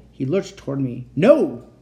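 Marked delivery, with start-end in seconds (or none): 1.2-1.7 loud, high refusal; screaming. A narrator's reading. Uncle stops the boy lunging forward, screaming. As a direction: bellow it in alarm. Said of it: "Uncle Victor screamed".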